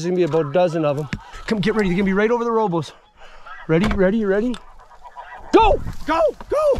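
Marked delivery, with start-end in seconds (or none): 5.5-6.8 sharp high yell to fire; shouted command. Real, not acted. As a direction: bark go at the hunters. Calling the shot on ducks.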